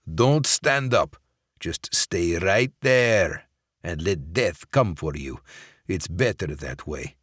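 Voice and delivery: slow, cold Russian-boss gloat. Acted. Sokolov gloating over the poisoned Barrett. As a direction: savour his victory slowly. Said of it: "Sokolov gloats at dying man".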